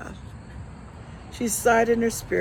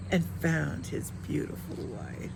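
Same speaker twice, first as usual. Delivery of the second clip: ordinary delivery, nothing marked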